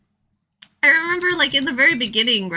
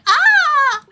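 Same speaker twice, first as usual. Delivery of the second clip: sudden high loud yelp; a sharp surprised cry; startled shriek; 9 dB louder than usual. Retold or imitated. She reacts in game scene.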